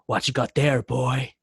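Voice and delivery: understated angry challenge; mildly angry biker. Shown as a demonstration. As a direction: demand it with a touch of anger. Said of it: "supposed to come in really angry".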